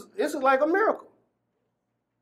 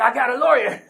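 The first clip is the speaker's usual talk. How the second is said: higher, louder, laughing as he says it; laughing joy; 4 semitones higher, 5 dB louder than usual. Retold or imitated. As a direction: burst out with the good news, laughing. Tells guard the good news.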